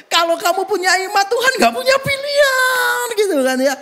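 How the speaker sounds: high, incredulous mock repetition; scoffing high voice quoting the book; mocking disbelief. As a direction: repeat it in a squeaky mocking tone. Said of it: "What? laughs".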